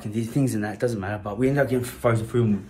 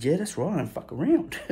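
higher, swaggering brag; cocky boast; 7 semitones higher than usual. Retold or imitated. Teen boasting to the bully about the gun. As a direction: brag like a tough kid.

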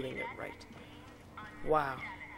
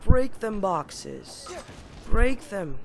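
urging; 3 semitones higher, 9 dB louder, much slower than usual. Real, not acted. Urging himself on.

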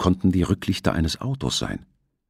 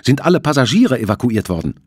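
anxious; 6 semitones higher, 6 dB louder than usual. Acted. Shipowner asks anxiously.

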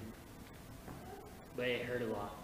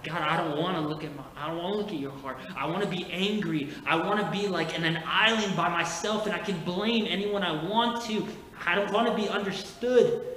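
louder, rising, fast protest; yelling back at God; 5 semitones higher, 7 dB louder than usual. Retold or imitated. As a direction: shout your refusal at God, building. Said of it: "I'm yelling back".

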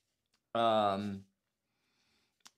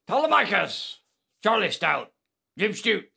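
high, raised roll call; 6 semitones higher, 4 dB louder than usual. Pretend. Host greeting viewers by name, high hailing call. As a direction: hail each name in a raised voice.

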